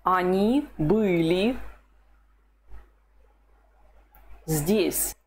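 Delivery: Они были здесь said slowly, word by word; slow model answer. Shown as a demonstration. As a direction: lay the sentence out slowly. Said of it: "What's the Russian for".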